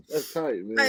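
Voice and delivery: normal talking voice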